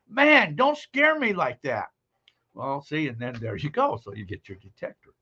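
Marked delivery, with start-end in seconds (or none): 0.1-1.9 high, slow mock relief; hammed-up relieved wife scolding. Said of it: "Then she'll say".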